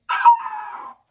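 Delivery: very high, loud squealed "ah"; hammed-up shriek to cap the song; high mock scream. Capping his drawn guy's anthem, high mock scream.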